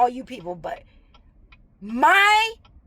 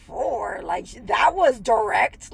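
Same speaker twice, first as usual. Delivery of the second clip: stunned echo; 7 semitones lower, slower than usual. Retold or imitated. Hearing the coworker's blunt number, taken-aback echo. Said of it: "I looked at her like".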